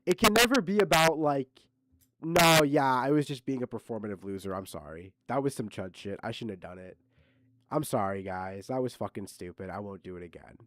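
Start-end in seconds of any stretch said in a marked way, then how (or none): 2.2-10.6 lightly voiced contrite apology; mock apology. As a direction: mumble a half-hearted sorry. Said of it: "That should have been the, the real honest apology".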